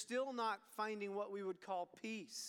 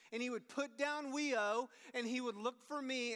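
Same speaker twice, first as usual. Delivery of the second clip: ordinary talk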